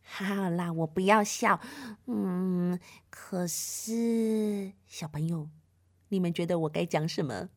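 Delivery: soft, embarrassed mumble; embarrassed little sister, speaking softly; sheepish. Acted. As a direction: sheepishly give in. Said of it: "不好意思地说".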